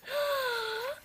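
a real fangirl scream; excited scream. Real, not acted. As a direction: shriek with fan joy. Fan sees photocard, screams.

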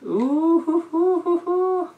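very high, sustained "ooh-hoo-hoo"; high-pitched sing-song hoots; high hooting. Real, not acted. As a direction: hoot playfully in a high head voice. Wordless hooting after the reading.

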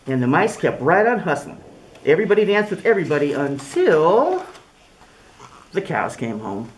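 lively storybook voice with big swings; reading to children. Acted. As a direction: read it like story time for little kids. Reads picture book aloud.

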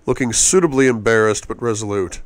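plain reading voice

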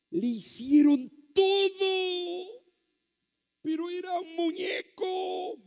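impassioned shouted preaching, voice pitched very high; full-force preaching. Real, not acted. Preacher urging the crowd that Christ wants to touch them tonight, shouted preaching. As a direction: cry it out at the top of your range, loud and drawn high.

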